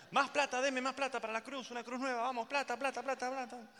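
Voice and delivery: high, insistent mock demand; greedy church voice. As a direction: chant the demand like a greedy cleric. Acts church demanding money.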